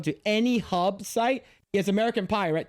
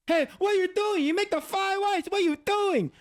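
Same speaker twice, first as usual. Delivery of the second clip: Uncle Roger; 11 semitones higher than usual. Retold or imitated. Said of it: "Uncle Roger accent".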